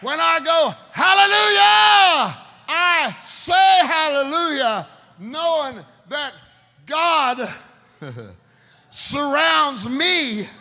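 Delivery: loud, slow, ringing sermon voice; fiery preacher calling out; full-force preaching. Acted. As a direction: preach it out to the whole church.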